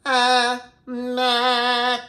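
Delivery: high, loud nasal ahs; nasal, in the mask. Shown as a demonstration. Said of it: "you can hear it get a little more nasal, get into my mask".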